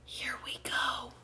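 hushed, breathy whisper. A narrator's reading. Pelican secretly to the boy before take-off, whispering. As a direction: whisper it very softly. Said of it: "the pelican whispered to me".

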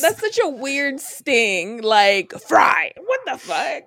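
loud, baffled disbelief; loud baffled outrage; incredulous. Real, not acted. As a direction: react like it's nonsense. Incredulous at commenter's word.

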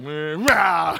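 loud snarling rah; piranha growl. Retold or imitated. Preacher imitating the belt biting like a piranha, loud growl. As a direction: bark it like a biting fish. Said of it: "It was like".

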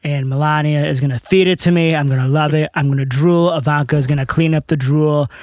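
bragging presidential impression in run-on phrases; Trump. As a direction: boast about the meal like a brash politician. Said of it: "what would Trump and Obama say".

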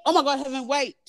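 high, exasperated aside; real exasperation. Real, not acted. Storyteller interrupted by someone nearby, real exasperated call.

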